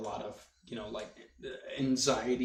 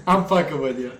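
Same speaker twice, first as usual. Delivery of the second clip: laughing; 5 semitones higher, 10 dB louder than usual. Real, not acted. Teasing friend, then laughing.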